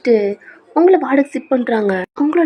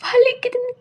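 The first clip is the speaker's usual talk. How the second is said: very high pained whine; acted cry of pain; 11 semitones higher than usual. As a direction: wail it in agony. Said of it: "கத்துறீங்க".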